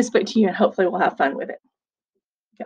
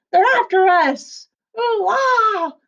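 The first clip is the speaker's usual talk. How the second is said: high, louder frantic cry; panicked pirates; 11 semitones higher, 4 dB louder, slower than usual. A narrator's reading. Pirates fleeing in panic, high and louder. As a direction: squeal as you run away.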